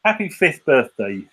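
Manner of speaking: ordinary talk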